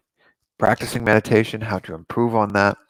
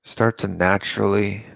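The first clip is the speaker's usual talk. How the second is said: guided meditation. Acted. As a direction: ease them into calm breathing, unhurried.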